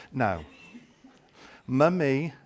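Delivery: normal talking voice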